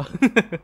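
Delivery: high, loud burst of laughter; sudden high laugh. Real, not acted. Laughs after the accusation.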